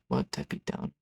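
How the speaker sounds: very low, barely voiced mumble; muttered disbelief. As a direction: mutter it under your breath. Muttered reaction to insult.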